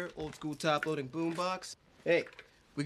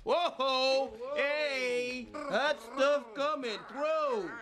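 rowdy hollering; 9 semitones higher, much slower than usual. Acted. Man geared up for man task, hollering excitedly. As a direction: holler it out with swagger.

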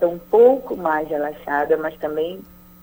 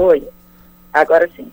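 louder, calling down a bad line; calling over bad line; 9 dB louder than usual. Real, not acted. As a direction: make sure they hear you. Line restored, answering loudly.

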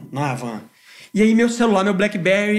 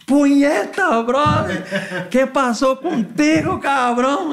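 higher-pitched, louder voicing of a friend's rowdy shout; bandmate's excited call; 9 semitones higher, 4 dB louder, much slower than usual. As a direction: play the pal yelling at you from across the van. Friend Ramon voiced in story.

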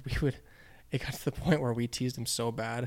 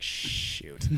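under his breath; 10 semitones lower, 4 dB quieter than usual. Retold or imitated. Teen sees brother, caught.

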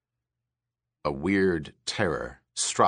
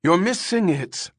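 high, louder anxious call-out; 11 semitones higher, 5 dB louder than usual. Acted. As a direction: call out anxiously to the next room. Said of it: "Irmgard called anxiously to Pris".

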